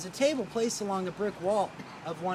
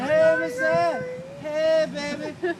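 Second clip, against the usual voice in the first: put-on slow, slurred drunk bellow, much higher and louder; loud drunken shout; 9 semitones higher, 11 dB louder, much slower than usual. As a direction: holler up at the window like a drunk. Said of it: "Very loud, drunk, and obnoxious".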